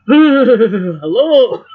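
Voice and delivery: loud, high, strained hello; freezing hello. Retold or imitated. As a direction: blurt it out half frozen. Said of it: "It wasn't like that. It was a".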